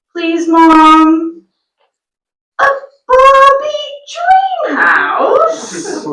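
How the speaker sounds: put-on high, slow pleading child; drawn-out high child's plea; begging child. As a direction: whine like a small girl begging.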